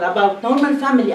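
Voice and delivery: usual speaking voice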